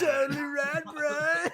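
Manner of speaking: high, loud surfer-dude shout; Californian surfer. Acted. Hyping up forty rad tracks, loud Californian surfer. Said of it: "bringing out that Californian surfer again".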